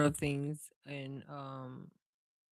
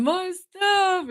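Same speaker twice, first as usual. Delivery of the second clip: much higher, wailing imitation of a crying man; mock crying wail. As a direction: wail it high like a man crying over his bike. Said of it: "He was crying".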